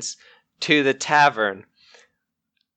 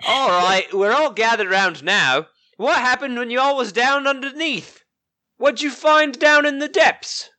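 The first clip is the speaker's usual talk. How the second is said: a lightly voiced Rofain, rising higher; corrected character voice; 8 semitones higher than usual. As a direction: settle back into his proper voice. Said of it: "That's not his voice".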